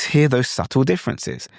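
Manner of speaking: ordinary talk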